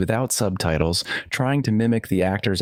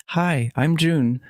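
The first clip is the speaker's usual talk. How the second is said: nervous; 7 semitones higher, slower than usual. A narrator's reading. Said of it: "I introduced myself nervously".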